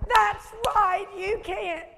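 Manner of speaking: high, loud acted agreement; emphatic retort. Preacher retelling her answer to the Princeton girl, emphatic retort.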